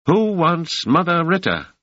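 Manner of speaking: put-on high calling old woman; old woman calling through her door; shrill old woman calling. A narrator's reading. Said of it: "A shrill voice called out".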